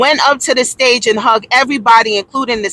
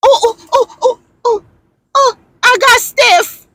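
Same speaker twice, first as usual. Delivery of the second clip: starstruck squeals; 10 semitones higher than usual. Pretend. Starstruck at [name].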